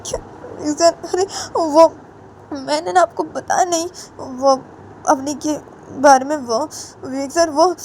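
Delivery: high, halting student voice; hesitant Samar. A narrator's reading. Student nervously starts telling his teacher about Avni, high and hesitant.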